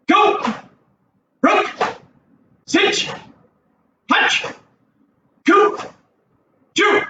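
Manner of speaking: high, sharp counting calls; fast count calls. Shown as a demonstration. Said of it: "go a little faster this time".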